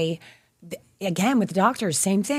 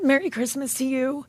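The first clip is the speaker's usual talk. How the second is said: choked up; 6 semitones higher, 4 dB quieter than usual. Real, not acted. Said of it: "So I get choked up when I talk about this".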